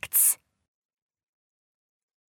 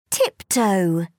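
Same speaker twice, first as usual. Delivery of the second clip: ordinary delivery, nothing marked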